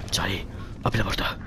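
whisper. Real, not acted. Sneaking in horror game.

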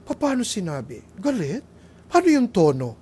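rising to a loud, high pitched wife demanding the tone; women asking about tone. Retold or imitated. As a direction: build from a question to a shrill protest. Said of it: "Ang mga babae ... rapport".

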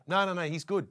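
high, loud no no no; brushing off the waiter, mock urgent; hurried protest. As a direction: wave it off fast and eager. Waving off the waiter's payment.

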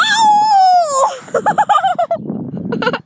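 high screaming burst of laughter; shrieking laugh. Real, not acted.

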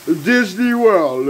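drawn-out high, loud hype; hyped shout-out. Real, not acted. Cook hyping his food to viewers, loud and high. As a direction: sell it to the viewers like a showman. Cooking vlog hype callout.

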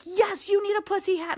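retold gleeful yell; excited call back. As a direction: shout back with delight. Woman calling back excitedly.

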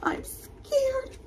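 be a tiny terrified rabbit; scared rabbit. Acted. Teacher voicing Rabbit in the class story, high and scared. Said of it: "cried Rabbit".

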